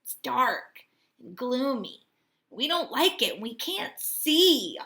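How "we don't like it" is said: ordinary delivery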